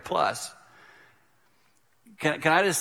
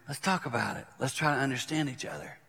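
gentle calm talk; 8 dB quieter than usual. Retold or imitated. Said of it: "I'm going to".

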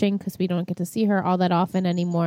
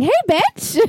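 very high, rising squeal; catchphrase; 12 semitones higher than usual. Shown as a demonstration. Host showing what she likes saying, high squeal. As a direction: sing it out high and long. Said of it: "I just like saying".

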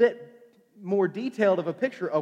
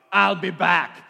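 put-on loud Austrian-accented Terminator line; Arnold Schwarzenegger; 8 dB louder, slower than usual. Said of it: "in an Austrian accent like Arnold Schwarzenegger".

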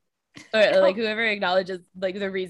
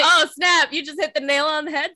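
higher and louder, quick and hyped; mock impressed; 6 semitones higher, 4 dB louder than usual. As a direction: act blown away by a smart remark. Mock impressed reaction to Summer.

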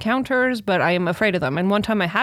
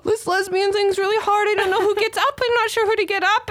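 flustered panic; 11 semitones higher than usual. Retold or imitated. Retold mock panic.

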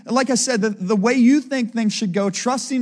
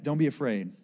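low, hushed comfort; calm them very softly; tender, much lower and softer; loving reassurance; 8 semitones lower, 9 dB quieter than usual. Acted. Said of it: "it's this loving, gracious call".

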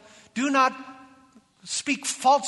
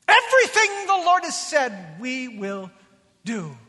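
ringing, drawn-out pledge imitating the people; bold proclamation; 6 dB louder than usual. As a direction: proclaim it as a whole crowd's vow.

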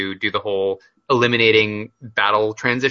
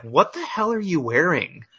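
imitating baffled onlookers, pitched up; mock incredulous; 8 semitones higher, 3 dB louder than usual. Characters react to her skimpy costume.

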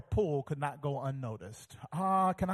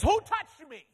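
Jesus asking; 10 semitones higher, 7 dB louder, slower than usual. Retold or imitated. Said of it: "And he says".

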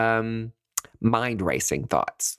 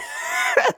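imitated noise. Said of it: "I go".

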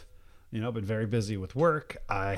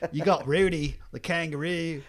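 mock Australian accent; 5 semitones higher, 4 dB louder than usual. Voicing Australian Santa's kangaroo team.

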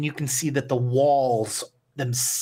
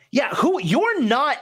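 much higher, pushy retort; indignant boss; 9 semitones higher than usual. Acted. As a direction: cut in sharply, rising. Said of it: "He goes".

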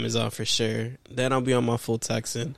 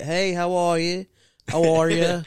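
friendly Texan greeting; 4 semitones higher than usual. Retold or imitated. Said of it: "they'll be like".

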